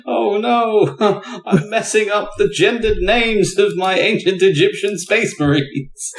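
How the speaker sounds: high-pitched mock panic; mock dismay. Player making fun of worrying over space marine names, mock dismay.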